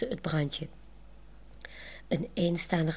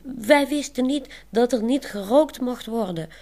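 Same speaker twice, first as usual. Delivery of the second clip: higher, louder call back to the guard; a raised call, higher and louder; 6 semitones higher, 6 dB louder than usual. Acted. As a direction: call it over to him. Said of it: "roept ze terug".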